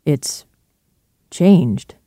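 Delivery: long pause before "changed"; hesitant. A narrator's reading. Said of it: "Utterson hesitated".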